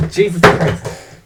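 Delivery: slow drawn-out exclamation; exclaiming. Acted. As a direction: stretch out Jesus Christ.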